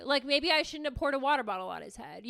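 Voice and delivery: ordinary delivery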